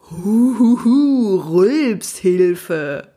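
slow, loud delighted hoho; gleeful chuckle. Acted. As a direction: chuckle with greedy joy. Unicorn delighted by lemonade.

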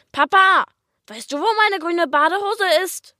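hohe, laute Kinderstimme ruft nach Papa; hoch geschrien. Acted. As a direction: quer durchs Vorzimmer rufen. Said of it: "schrie ich". (Kind ruft den Papa wegen der grünen Badehose)

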